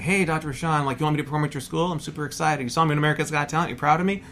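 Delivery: retold eager excitement. Hungover student gushing to his provost on the phone, eagerly excited. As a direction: gush lightly, seeking approval.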